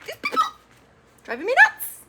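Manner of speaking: fed-up shriek at these people; exasperated squeal. Real, not acted. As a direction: pitch shooting way up into a squeal. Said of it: "I cannot handle it".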